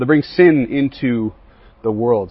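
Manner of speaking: ordinary delivery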